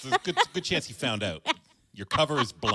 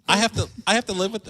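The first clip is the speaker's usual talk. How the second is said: higher, louder mock whine; mock exasperation; 11 semitones higher, 6 dB louder, faster than usual. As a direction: pretend to be long-suffering. Mock complaint repeat.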